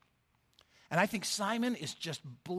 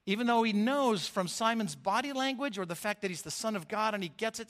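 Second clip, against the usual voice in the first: ordinary talk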